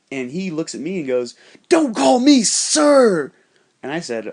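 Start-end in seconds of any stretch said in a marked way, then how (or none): 1.7-3.3 high, very loud, drawn-out retort; hammed-up shouted outrage; mock furious yell. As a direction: bellow it slowly as the offended guy. Acting out angry man's retort.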